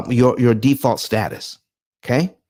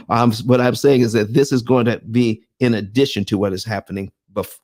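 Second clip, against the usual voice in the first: normal talking voice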